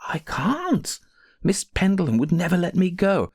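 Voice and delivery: high-pitched, helpless protest; crying. A narrator's reading. Maid says her mistress will never release her, crying. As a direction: protest hopelessly, pitch lifted. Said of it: "began to cry".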